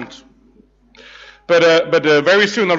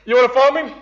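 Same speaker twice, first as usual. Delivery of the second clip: Jesus, amused; 5 semitones higher than usual. Retold or imitated. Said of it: "And Jesus like".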